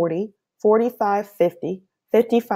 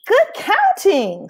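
high, slow sing-song praise; drawn-out warm grandmother voice; grandmother's praise; 13 semitones higher, 5 dB louder, slower than usual. Acted. Abuela praising Pablo's counting, slow and sing-song.

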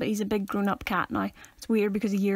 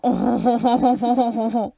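loud, hammed-up French oh-ho-ho; exaggerated French-style chortle; French laugh; 5 dB louder than usual. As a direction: laugh with a dropped jaw, mock French. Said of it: "But then if you're French, it's more".

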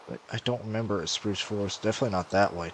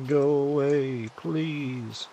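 whiny plea; 6 semitones higher, much slower than usual. Acted. Shooing mob in game.

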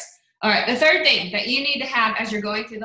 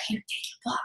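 hushed, voiceless aside; pretend whisper; 10 dB quieter than usual. Whispering to Aunt Sally at dinner, passing her Block. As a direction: slip it to her in a secret whisper. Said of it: "you know, whisper to Aunt Sally".

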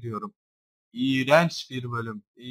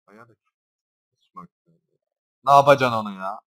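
usual speaking voice

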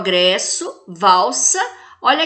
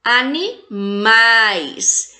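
"Animais" very slow, split before a long, strong "mais"; stressed last syllable; much slower than usual. Shown as a demonstration. Teacher showing an oxytone word to class, "mais" stressed in "Animais". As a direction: stretch and punch the final "mais" of "Animais". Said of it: "A última sílaba é a sílaba tônica".

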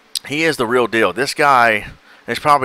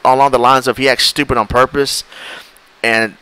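ordinary talk, nothing marked

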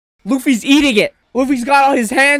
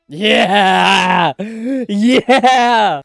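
long drawn-out cheer; real excited holler. Real, not acted. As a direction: cheer it out, stretching the word. Cheering on the fight.

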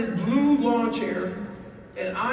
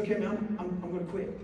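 much softer, giving up; soft, lowered surrender; quiet defeat; 4 semitones lower, 8 dB quieter than usual. Retold or imitated. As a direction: murmur that you're done. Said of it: "I said".

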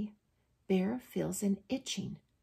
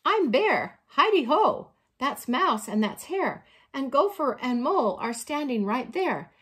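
high, loud storybook greeting; friendly bear introductions; 5 semitones higher, 7 dB louder than usual. A narrator's reading. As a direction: introduce everyone eagerly as Bear.

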